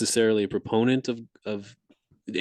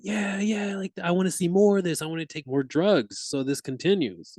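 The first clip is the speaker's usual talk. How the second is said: high, giddy and hooked; entranced voice; 9 semitones higher than usual. Retold or imitated. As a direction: beg for more, wide-eyed and giddy. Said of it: "And I'd just be like".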